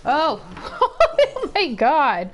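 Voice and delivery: high laughing shriek of disbelief; laughing outcry. Real, not acted. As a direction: burst out laughing, drawing the words out slowly. Time runs out, laughing outburst.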